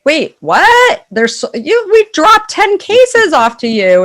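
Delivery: as if you can't believe what you heard; stunned disbelief. Retold or imitated. Retold disbelief cases were sold.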